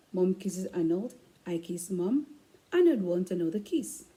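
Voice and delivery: ordinary delivery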